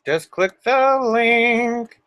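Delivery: very slow stretched invite; drawn out. Acted. Streamer inviting viewers, slow drawn-out invitation. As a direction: stretch every word out. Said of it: "stretched invite".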